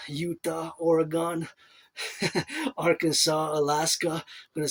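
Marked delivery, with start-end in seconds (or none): 2.0-2.7 a quick giggle breaking the list; short high laugh. Real, not acted. Laughing to himself while reciting the states. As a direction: laugh at yourself mid-list.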